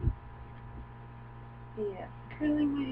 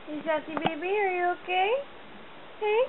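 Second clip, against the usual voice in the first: high cooing pet voice; 8 semitones higher, faster than usual. Real, not acted. Owner coos to her frightened dog, high baby talk. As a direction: coo to the scared dog, high.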